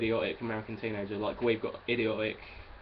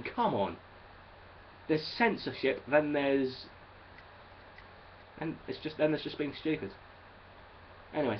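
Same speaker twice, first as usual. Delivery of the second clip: ordinary talk, nothing marked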